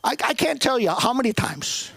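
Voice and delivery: normal talking voice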